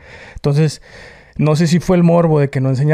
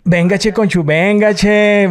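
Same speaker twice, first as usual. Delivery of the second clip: northern Mexican catchphrase; 6 semitones higher, slower than usual. Retold or imitated. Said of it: "así como chiquiadón".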